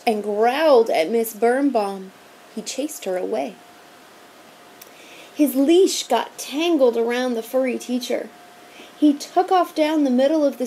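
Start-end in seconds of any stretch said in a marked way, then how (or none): none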